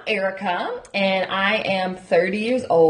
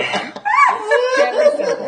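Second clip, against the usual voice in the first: high, loud, slow laughing mockery; mock your mom's fussing, squealing; 3 dB louder, much slower than usual. Daughter mocking mom for being extra, high laughing squeal. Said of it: "it just can't be simple with me".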